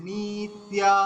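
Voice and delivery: "নিত্যা" with both vowels drawn out; long vowels. Shown as a demonstration. Teacher showing long syllables, "নিত্যা" stretched. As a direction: hold the ী and the final া of "নিত্যা". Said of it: "দীর্ঘ, তয় জফলা আকার দীর্ঘ".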